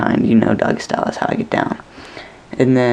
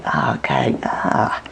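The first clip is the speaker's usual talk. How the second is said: low, soft, nearly whispered disgust; disgusted mutter; 10 semitones lower, 4 dB quieter, slower than usual. Retold or imitated. Dreamer muttering at the nasty scene, disgusted. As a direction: grumble it under your breath. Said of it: "and it's like".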